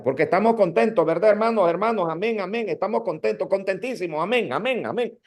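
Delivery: mock a preacher overusing amén and hermanos; sing-song exaggerated preacher parody; overdone filler-word preacher. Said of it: "te usan las muletillas".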